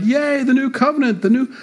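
high, gleeful cheering; excited cheer. Retold or imitated. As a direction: cheer like a happy crowd. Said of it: "All these Gentiles were like".

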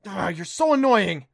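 loud, slow exasperated complaint; complaining. Acted. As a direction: whine it out in frustration. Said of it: "he complained".

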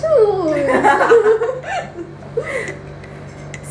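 high burst of laughter. Real, not acted. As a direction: crack up laughing. Big laugh at issues joke.

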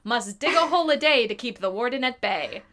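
slow, hammed-up blurb read; dramatic reading. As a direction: read it like an epic trailer. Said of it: "kind of hilarious".

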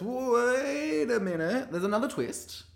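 very high, loud, stretched mock interruption; host impression. Host springing another twist on queens, high hammy interruption. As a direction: swoop up high to halt everyone theatrically. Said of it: "she goes".

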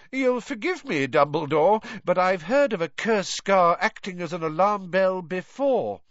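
high-pitched, blustering official; pompous sceptical rebuttal in a high voice; Fudge, stubborn. A narrator's reading.

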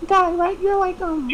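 hesitant, very high falsetto; halting mock girl voice. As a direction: imitate a drunk girl ordering, way up high.

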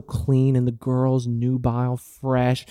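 very slow, drawn-out relish; dwelling slowly on the porn he likes, in mock lust; mock lustful savoring. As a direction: savour every word like a treat.

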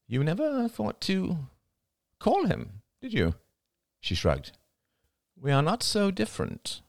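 put-on young woman character voice. Crystal's niece pleading with her aunt, character voice. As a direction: give the character her own bright young voice.

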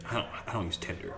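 hushed, quick whisper; whispered aside. Real, not acted. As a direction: whisper it like a confession.

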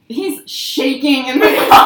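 laughing through the words, loud; real laughter. Real, not acted. Telling friends about him shaking, laughing hard. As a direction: crack up while telling it, drawn out.

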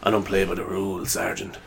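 low-key, soft rebel cop voice; quiet TV-cop defiance; maverick cop. Acted. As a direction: play the loose cannon, soft and understated. Second try at maverick cop line.

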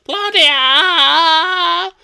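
long, very high wail of a name; mock anguished scream. Mimics grief-stricken cry for Claudia.

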